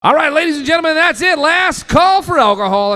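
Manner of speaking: high, loud crowd announcement; tired last call shout. Real, not acted. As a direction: call it out over the arena.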